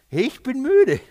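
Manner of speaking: high, loud exasperated outburst; exasperated weariness. Retold or imitated. Jesus tells the faithless generation he is tired, exasperated. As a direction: cry it out, fed up and disappointed. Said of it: "Warum ist er so enttäuscht?".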